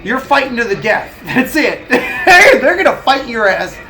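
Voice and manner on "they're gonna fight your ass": laughing. Real, not acted. Laughing at own joke.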